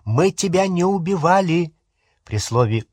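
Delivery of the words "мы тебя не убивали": higher-pitched, urgent denial; mild cry. A narrator's reading. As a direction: protest urgently, voice lifted. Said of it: "крикнул он". (сдержанный крик протеста)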